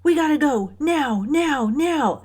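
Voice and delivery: doctor urging the staff to move now; urgent order. Retold or imitated. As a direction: hurry everyone out, retelling the doctor. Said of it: "And she's like".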